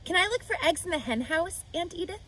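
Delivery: put-on a child asking her aunt nicely; Fern, high and hopeful. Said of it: "asks her aunt".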